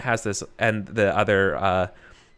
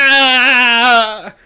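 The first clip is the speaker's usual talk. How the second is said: high, loud scream of pain; acted scream; 14 semitones higher, 11 dB louder than usual. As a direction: scream out as the tortured captive. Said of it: "He's obviously screaming".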